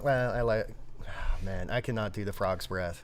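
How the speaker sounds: usual speaking voice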